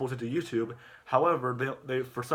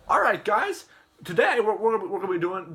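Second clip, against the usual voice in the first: high, loud mock YouTuber opening; hyped video intro; 8 semitones higher, 6 dB louder than usual. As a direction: kick off the video with put-on pep, settling down. Acted video host's opening.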